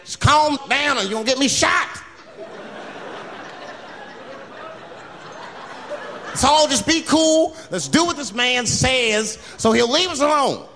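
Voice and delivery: high, pleading call for calm; urgent appeal. Acted. Pleads with panicking passengers.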